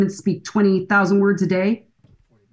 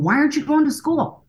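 frustrated dad's demand, pitched higher; barked demand; 6 semitones higher than usual. Retold or imitated. Frustrated dad demanding answers from his daughter, mock bark. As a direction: snap the question out like an order. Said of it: "when you're barking an order".

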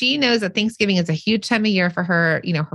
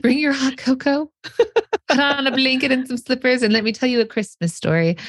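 high, cozy fireside storyteller voice; Mrs. Claus story voice; 6 semitones higher than usual. Pretend. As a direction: tell it like Mrs. Claus by the fire. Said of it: "Missus Claus is in".

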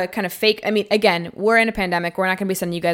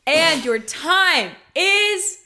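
slow, drawn-out reveal; dramatic announcer; 7 semitones higher, much slower than usual. Acted. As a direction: stretch it out like TV hosts. Host ends the cooking round.

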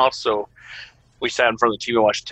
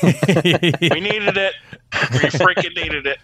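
drawn-out, higher joking celebration; hammed-up elation, higher and stretched; mock excitement; 6 semitones higher, slower than usual. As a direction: play up the desperate gratitude as a joke. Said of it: "I'm just joking".

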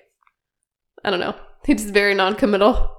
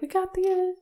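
high, soft mock squeal; quietly squealed, held-in excitement; hushed excited squeal; 9 semitones higher, 8 dB quieter than usual. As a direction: imitate thrilled news in a small high voice. Acted quote of excited news.

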